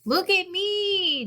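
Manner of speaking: high, slow call to be seen; calling out proudly; excited cry. A narrator's reading. Douglas shows off his hat to the sheep, excited high call. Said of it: "Douglas cried".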